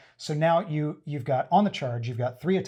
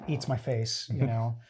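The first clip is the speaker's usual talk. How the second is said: usual speaking voice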